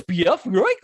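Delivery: goofy cartoon dog voice, high and loud; loud goofball impression with swooping pitch; Dynomutt. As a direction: do a big, daffy cartoon sidekick. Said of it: "Dynomutt in his goofy voice".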